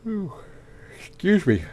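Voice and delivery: talking through a big yawn; stretched yawn with words. Real, not acted. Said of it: "big yawn".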